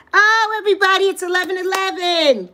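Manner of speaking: high, loud excited call; high loud thrilled announcement. Real, not acted. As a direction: announce it with delight.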